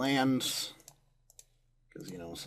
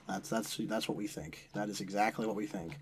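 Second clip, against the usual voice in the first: ordinary delivery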